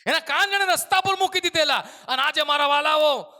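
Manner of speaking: loud, high preacher's cry; rising revival sermon shout; fiery preaching. Acted. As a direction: preach it out with full force. Preaching at full force.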